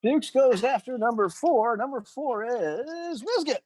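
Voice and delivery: high, slow, drawn-out mock announcer; game-show announcement. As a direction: stretch out the big reveal in a high voice. Answers "Survey says" like show host.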